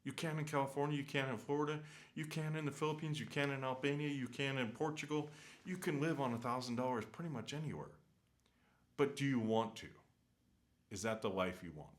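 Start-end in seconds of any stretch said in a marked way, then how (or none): 0.0-9.9 heated rant, pitched well higher; worked up. Real, not acted. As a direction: build the point, repeating it with rising heat. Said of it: "getting emotional".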